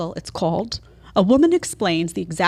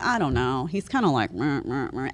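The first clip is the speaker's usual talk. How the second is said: bored, dismissive, dropping lower and softer; mocking blah blah; 8 semitones lower, 8 dB quieter than usual. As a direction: shrug him off with a bored meh. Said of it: "you get bored and you're like".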